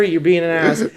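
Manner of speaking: normal talking voice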